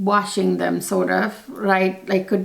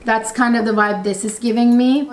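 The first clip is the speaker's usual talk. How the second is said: usual speaking voice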